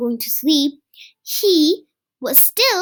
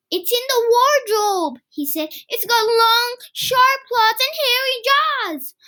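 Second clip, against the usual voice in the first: put-on excited little boy; 7 semitones higher than usual. Kipper describing monster.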